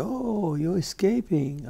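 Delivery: German seatmate recognizing him, surprised; surprised realization. Retold or imitated. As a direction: let it dawn on you, pitch up.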